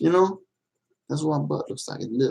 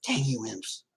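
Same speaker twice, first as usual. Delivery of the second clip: much softer, grumbling mock curse at the imps; hammed-up villain grumble; 12 dB quieter than usual. Streamer mock-curses the chat for spilling the secret, soft villain grumble.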